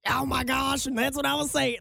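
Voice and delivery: mock deep, manly voice. Shown as a demonstration. Host showing her other voice, mock deep voice. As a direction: put on a slightly gravelly manly voice. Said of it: "my deep voice".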